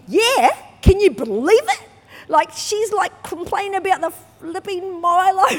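high, loud can-you-believe-it rant; loud, high outraged gossip; indignant venting. Retold or imitated. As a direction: vent to the pastor expecting sympathy. Venting to the pastor.

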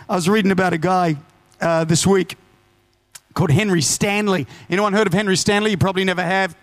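ordinary delivery

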